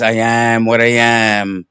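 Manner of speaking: deep, slow, solemn divine voice; voice of God. Acted. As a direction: speak slowly and low, like God from the mountain. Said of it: "And God says".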